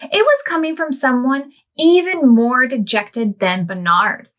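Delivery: gentle storybook reading to little ones; reading to children. A narrator's reading. Storyteller reading to children, gently. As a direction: read it like a bedtime picture book. Reads picture book aloud.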